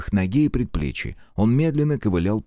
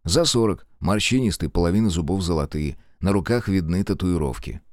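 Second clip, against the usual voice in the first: ordinary talk, nothing marked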